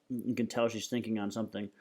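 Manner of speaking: ordinary delivery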